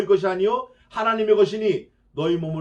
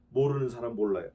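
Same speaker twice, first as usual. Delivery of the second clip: ordinary delivery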